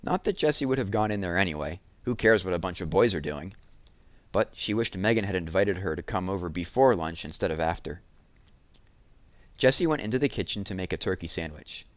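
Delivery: plain reading voice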